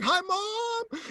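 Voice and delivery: very high, drawn-out call; excited yell. Retold or imitated. Jumper greets mom on the recording, excited yell. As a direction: call out giddily to the camera. Calls to camera in free fall.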